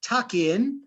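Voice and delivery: a hint of a cheery pig mum calling to eat; Mommy Pig. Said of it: "Mommy Pig says".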